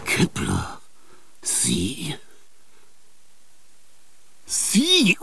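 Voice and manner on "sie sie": usual speaking voice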